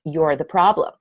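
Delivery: ordinary talk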